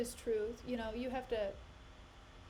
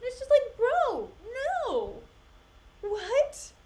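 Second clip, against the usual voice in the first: exasperated; 8 semitones higher, 7 dB louder, slower than usual. Real, not acted. Ranting about double standards.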